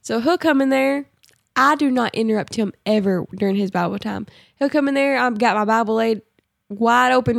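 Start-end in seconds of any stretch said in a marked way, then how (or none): none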